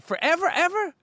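high-pitched incredulous echo; mock-astonished lyric quote; quoted song hook. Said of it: "the great Big Boy said".

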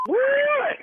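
high shocked "What?"; jolted, voice shooting way up; surprised. Real, not acted. Prank victim learns it was a joke, shocked high "What?".

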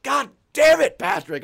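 loud, high exasperated yell; angry cursing outburst. Retold or imitated. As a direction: curse him out, loud and high. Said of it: "just curses him out".

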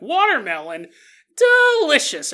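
delicious shoots up high and loud; hammed-up swoon over watermelon; mock delight. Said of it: "I'm like".

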